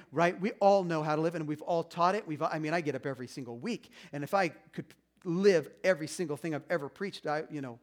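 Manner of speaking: ordinary talk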